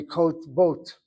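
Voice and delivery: "kot bot" in two syllables, no vowel after the t; closed first syllable. Shown as a demonstration. Teacher showing how a shvanach would sound, two closed syllables. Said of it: "If this were truly a shvanach".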